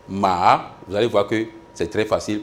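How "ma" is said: "Ma" dipping low, then climbing back up; third tone. Shown as a demonstration. Teacher demonstrates the third tone, dipping then rising "Ma". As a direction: drop "Ma" down and swoop it up, drawn out. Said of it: "Le troisième ton".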